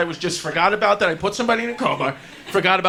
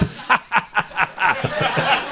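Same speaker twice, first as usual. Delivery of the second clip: imitated staccato laugh; dad's deliberate ha-ha-ha; mock laughing. Dad laughing at the ceiling after being insulted, mock laugh. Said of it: "he starts looking up the ceiling and laughing".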